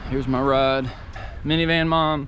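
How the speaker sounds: husband teasing his wife, loud and playful; playful tease. Real, not acted. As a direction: announce her new title like a tease. Teasing wife about her goal.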